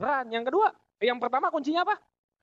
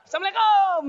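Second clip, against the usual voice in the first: higher, louder called-out greeting; calling greeting; 10 semitones higher, 7 dB louder than usual. Acted. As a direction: call it out at the door. Greeting at each house. (salam keras di depan pintu)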